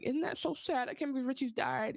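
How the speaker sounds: lightly voiced grieving friend; mock sad friend. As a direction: voice Ronnie's fake sympathy up high. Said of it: "and Ronnie's like".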